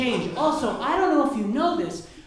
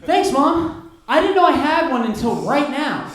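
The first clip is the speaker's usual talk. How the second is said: louder, higher deadpan sarcasm; sarcastic thanks; 4 semitones higher, 6 dB louder than usual. Retold or imitated. Son answering his mom, louder sarcastic thanks. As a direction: thank her with mock gratitude.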